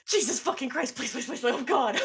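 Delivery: high, loud frantic babble; hammed-up desperate begging; flood of panic. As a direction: plead in a panicked rush. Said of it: "just instant flush of".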